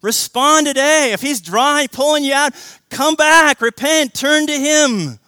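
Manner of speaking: loud, high, pleading sermon call; preaching at full force. Acted. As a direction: preach it out, urging them to turn back. Urgent appeal to respond.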